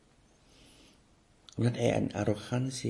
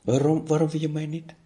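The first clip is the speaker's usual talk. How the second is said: pleading anger; 5 semitones higher, 6 dB louder than usual. Pretend.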